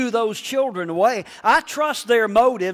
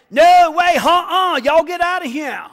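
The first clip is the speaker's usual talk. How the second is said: put-on disciples shooing kids; 7 semitones higher, 6 dB louder than usual. Voicing disciples shooing children away.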